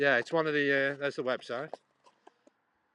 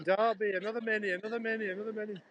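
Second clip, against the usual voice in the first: high sing-song self-quote, fading away; mock-excited repeated callout, pitched high; repeated exclamation; 8 semitones higher than usual. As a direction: chant it high like a running tally. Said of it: "I kept saying".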